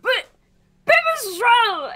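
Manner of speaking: a slow, croaked cry of the name; confused croak. Acted. Frog croaking the name in slow confusion. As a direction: croak it out, baffled. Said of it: "a confused croak".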